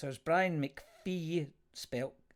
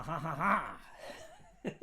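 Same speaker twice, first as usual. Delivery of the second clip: real drawn-out laugh; slow laugh; 4 semitones higher, much slower than usual. Real, not acted. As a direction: let the laugh roll out slowly. Laughing while reading.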